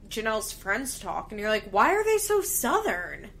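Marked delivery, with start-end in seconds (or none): 1.7-3.3 higher, louder baffled question; surprised. Retold or imitated. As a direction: ask it in disbelief. Surprised at friends' accents.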